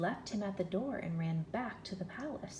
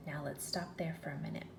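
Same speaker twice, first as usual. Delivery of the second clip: ordinary delivery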